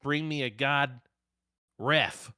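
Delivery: mock announcer call with a cut word; censored shout. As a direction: call for the ref, the curse bleeped out. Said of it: "And then he goes".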